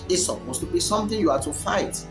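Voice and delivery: ordinary talk, nothing marked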